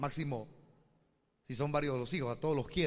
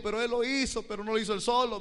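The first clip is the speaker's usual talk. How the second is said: higher, insistent mother's voice; defensive mother; 7 semitones higher, 4 dB louder than usual. Retold or imitated. Mother defending her son, higher and insistent. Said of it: "me dijo".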